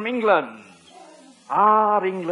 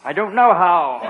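normal talking voice

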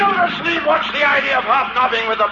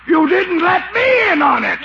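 angry outburst; 8 semitones higher than usual. Acted. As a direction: blurt it out furious.

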